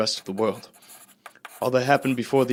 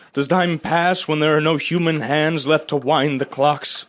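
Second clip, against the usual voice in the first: anguished questions; 4 semitones higher, 4 dB louder than usual. Acted. Survivor questions his own existence.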